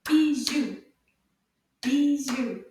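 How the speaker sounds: "Bijoux" in two loud, separate beats; syllable by syllable. Shown as a demonstration. Teacher claps the word with children, "Bijoux" loudly beaten out. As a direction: hit both syllables of "Bijoux" hard. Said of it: "we can clap this one".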